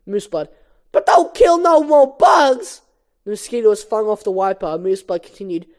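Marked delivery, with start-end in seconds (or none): 0.9-2.8 loud, high, slow plea; Chris Rock. Acted. Moose Blood pleads with the driver, high comedian shout. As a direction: holler it like a stand-up comic, stretched out. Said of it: "I'm gonna do the Chris Rock voice".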